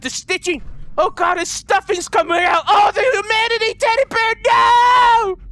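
high wail building to a loud scream; melodramatic horror. Pretend. Player seeing the teddy's stuffing coming out, melodramatic horror. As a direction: wail in hammed-up anguish.

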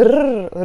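a long trilled r on its own; rolling R. Shown as a demonstration. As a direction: sustain the r as a tongue trill. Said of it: "rolling R".

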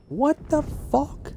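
a high, stretched-out what-the-heck; stunned disbelief. Real, not acted. Gamer killed from behind.

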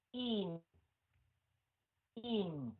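"In" falling into a held nasal N; nasal N ending. Shown as a demonstration. Teacher modelling the N sound, nasal-ending "In". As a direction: drop each "In" into the nose. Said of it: "Xuống giọng mũi luôn".

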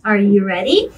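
usual speaking voice

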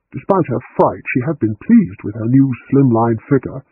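ordinary delivery

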